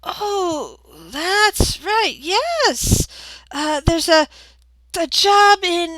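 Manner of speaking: put-on high-pitched old lady, recalling something; sweetly agreeable old receptionist; smiling old woman. Old woman confirming a job opening, high and agreeable.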